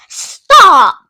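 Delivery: a loud, sudden stop command; sharp shout. Retold or imitated. Mister Fox halting the diggers, loud shout. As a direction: bark it out to halt the diggers. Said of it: "Mister Fox was like".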